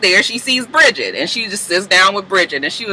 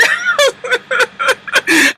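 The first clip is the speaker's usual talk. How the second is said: laughing cry. Real, not acted. Laughing mid mock cry.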